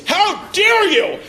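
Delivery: high, slow, hammed-up "How dare you?"; mock-indignant falsetto, drawn out; mock outraged gasp. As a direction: camp it up like a scandalized diva. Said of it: "you ever say the phrase".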